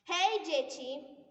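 slow loud cheerful call; shouting. Acted. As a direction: call out to the children. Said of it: "wykrzyknęła".

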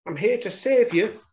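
high-pitched rescuing mouse; little mouse. A narrator's reading. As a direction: announce it in a small high voice. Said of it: "she said".

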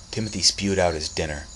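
ordinary delivery

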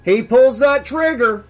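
forceful lawman calling out; loud sheriff. A narrator's reading. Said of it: "said Hoyt loudly".

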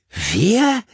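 high, loud rising yelp; incredulous question. A narrator's reading. Richard interrupting the caller's speech, incredulous. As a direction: swoop sharply upward in disbelief.

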